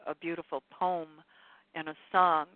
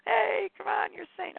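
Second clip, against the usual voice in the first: mock angry heckle, much higher; a jeering ego butting in, pitched way up; false self mocking; 14 semitones higher, slower than usual. Speaker voicing the angry false self heckling her. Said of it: "maybe the false self got angry".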